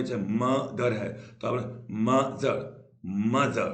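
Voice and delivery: मदर said slowly in two parts, मद and र; a slow, split मदर; broken syllables. Shown as a demonstration. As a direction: break मदर at its vowel. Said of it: "आप ये word को तोड़ेंगे".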